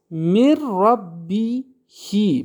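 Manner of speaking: ربی هی drawn out slowly with the ending هی as "hi"; slow recited ربی هی with a final "hi"; example recited. Shown as a demonstration. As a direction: chant میر ربی هی slowly, ending on a clear "hi". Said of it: "مثال میگه که بعد کسره".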